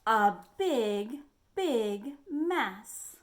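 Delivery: dramatic refrain. A narrator's reading. Refrain read dramatically to children.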